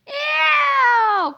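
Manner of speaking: very high, loud ew; disgusted cry. A narrator's reading. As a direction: shriek in disgust. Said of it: "cried George again".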